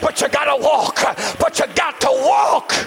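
high, shouted sermon build, slowing on forever; preaching at full force. Acted. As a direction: whoop it to the church like a revival preacher.

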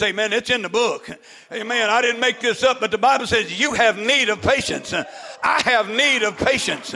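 usual speaking voice

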